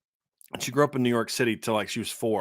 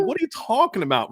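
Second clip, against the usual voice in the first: high, incredulous challenge; retold baffled comeback, pitched way up; mock disbelief; 12 semitones higher than usual. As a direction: question it in exaggerated disbelief. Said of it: "my Irish self was like".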